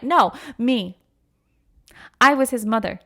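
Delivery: normal talking voice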